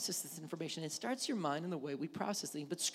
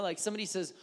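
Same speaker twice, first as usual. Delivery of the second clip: normal talking voice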